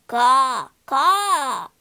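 loud cawing crow calls. Acted. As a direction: caw caw like a real crow, loud. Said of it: "he said".

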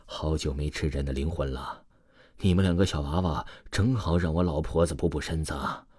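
low, soft aged demon crone; creepy old-woman voice. A narrator's reading. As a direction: cackle and creep like a soul-eating granny. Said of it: "嘴里发出的是一个苍老恐怖的声音".

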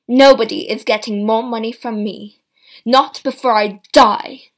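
loud, rising fury; furious accusation. A narrator's reading. Mother accusing her grown children of wanting her money, furious. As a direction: lash out at the greedy family.

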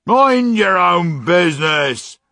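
slow, loud, high angry snarl. Acted. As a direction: snap it out angrily. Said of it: "said the man angrily".

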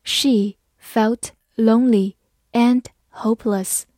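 slow, word by word with pauses; slow reading. Shown as a demonstration. Teacher models the example for learners, slow and separated. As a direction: read slowly, each word on its own. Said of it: "慢慢来读".

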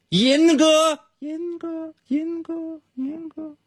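fading repeated echo; canyon echo. Pretend. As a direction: call out and let each repeat die away. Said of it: "能听见回声吗". (山谷回声)

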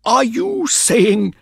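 high, slow outraged question; affronted, broken-off protest; angry indignation. A narrator's reading. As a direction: sputter it slowly in outrage, pitched high. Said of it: "he said angrily".

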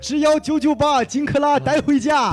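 high, slow TV shopping pitch; infomercial. Retold or imitated. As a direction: sell it like a cheesy TV ad.

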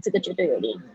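normal talking voice

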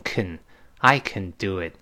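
"can" reduced to an unstressed "kn"; weak-form can. Shown as a demonstration. Teacher showing positive can, reduced to "kn". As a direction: say "can" with a schwa, passing quickly to the verb. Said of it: "那读成can".

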